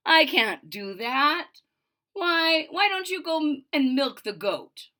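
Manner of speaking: slow, amused refusal; with a smile. Acted. Witch kindly refusing Big Anthony, slow and smiling. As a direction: turn him down kindly. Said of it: "with a smile".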